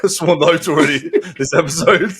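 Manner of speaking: giggling through the words, sheepish; laughing. Real, not acted. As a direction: laugh while owning up. Laughing at own swearing.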